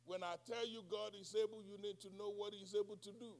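ordinary talk, nothing marked

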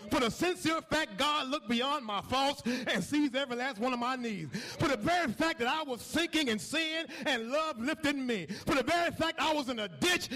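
rising preacher's cadence, growing to a shout; whooping gospel preaching; building sermon. Acted. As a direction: lift the congregation up, line after line. Pastor building to a climax.

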